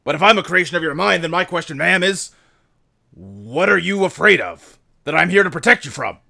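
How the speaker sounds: emphatic military questioning with pauses; earnest soldier dog. Acted. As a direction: press a superior respectfully but firmly. Plays Rex questioning his creator.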